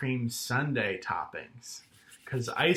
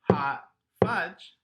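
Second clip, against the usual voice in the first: "Hot fudge" as two loud, separated beats; syllable by syllable; 3 semitones higher, 6 dB louder than usual. Shown as a demonstration. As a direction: punch "Hot", pause, punch "fudge". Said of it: "That has two syllables".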